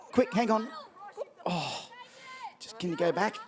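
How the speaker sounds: normal talking voice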